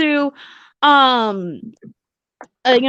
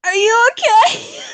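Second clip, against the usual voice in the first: asked through a burst of laughter, pitched up; laughing question; 7 semitones higher than usual. Real, not acted. Co-host laughing at her friend's reaction, laughing shout.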